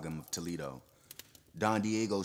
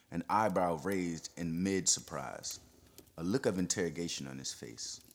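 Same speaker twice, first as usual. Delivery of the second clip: usual speaking voice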